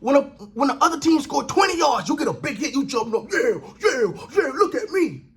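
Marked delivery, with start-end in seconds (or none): 3.3-5.2 laughing, taunting brag of a showboating player; a player crowing over a tackle, mocked by the host, in a cocky voice. Host mocking a showboating defender, laughing brag.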